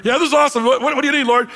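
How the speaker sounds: a happy guy interrupted mid-party; cheerful Lazarus. Pretend. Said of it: "Lazarus enjoying paradise, answering Jesus".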